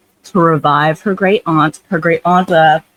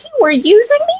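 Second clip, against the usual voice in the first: much higher betrayed squeak; mock shocked; faster than usual. Sarah learns fox used her.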